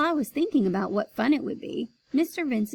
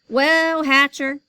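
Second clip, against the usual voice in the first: demanding; 4 semitones higher, 8 dB louder than usual. Acted. Boss demands answer.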